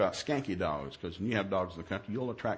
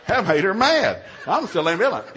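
usual speaking voice

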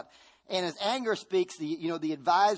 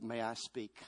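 gentle quiet ask; 5 semitones lower, 9 dB quieter than usual. Acted. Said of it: "the Holy Spirit said".

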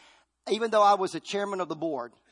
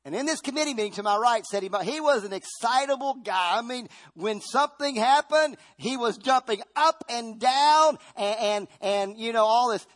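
excited; 7 semitones higher, 7 dB louder than usual. Acted. Acting the excitable emotion figure.

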